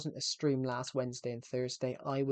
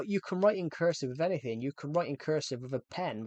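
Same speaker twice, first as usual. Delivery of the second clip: usual speaking voice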